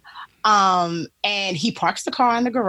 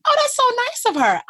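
mock naive delight; 4 dB louder, faster than usual. Her oblivious reply retold.

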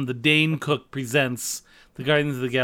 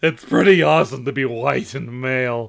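Ed Wynn; 6 dB louder than usual. Acted. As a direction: do a tittering Ed Wynn. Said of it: "I like Ed Wynn as Dane Cook".

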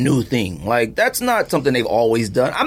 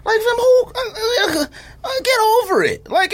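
exasperated squeal. Real, not acted. Exasperated rant at complainers.

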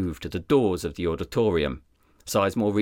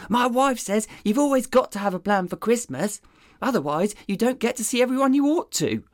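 much higher, dopey sidekick voice; dim deputy voice; 13 semitones higher, faster than usual. Acted. Deputy rambling to his boss about coffee, dopey and high. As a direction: play the clueless deputy.

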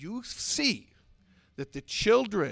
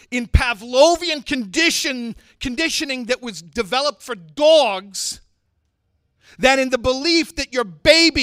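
preaching at full force; 8 semitones higher, 14 dB louder than usual. Acted. Rabbi exhorts mothers to trust their child's soul, loud fiery preaching.

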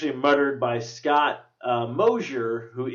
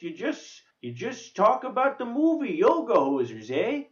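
hammed-up Canadian, eh, pitched high; Canadian accent; 6 semitones higher than usual. Teasing co-host in a mock Canadian accent. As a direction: lay the Canadian on thick, rising eh.

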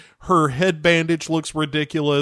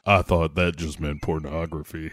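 Balrog dub; 9 semitones lower, 4 dB quieter than usual. Pretend.

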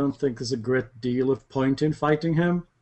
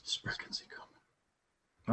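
whispering; 17 dB quieter, much slower than usual. Acted. Player echoes in a whisper.

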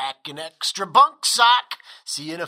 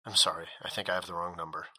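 flustered retreat; 12 semitones lower, 9 dB quieter, much faster than usual. Acted. Caller startled, hangs up quickly.

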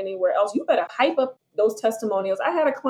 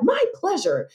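chirpy my pleasure; 7 semitones higher than usual. Retold or imitated. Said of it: "And they're like".

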